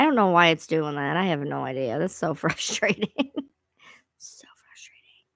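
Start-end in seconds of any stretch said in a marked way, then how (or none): 4.2-5.2 breathy unvoiced whisper aside. Real, not acted. Muttering to herself after the voice glitch, whispered. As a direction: hush it to yourself.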